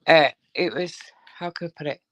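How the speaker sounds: ordinary talk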